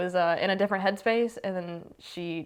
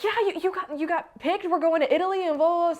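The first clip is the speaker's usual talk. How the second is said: high-pitched imitation of a thrilled mom; pitched-up excited retelling; 11 semitones higher, faster than usual. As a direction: gush in mom's higher voice. Voicing excited mom's news.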